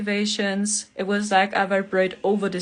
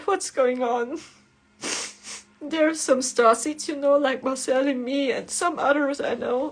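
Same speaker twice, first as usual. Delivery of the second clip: tearful; 5 semitones higher than usual. Real, not acted. Tearful rant about being attacked.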